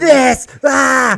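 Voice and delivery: high, loud mock rant; a hammed-up furious father yelling at home; imitating raging dad. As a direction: blow up like dad stomping in after work. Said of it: "he comes home and he goes".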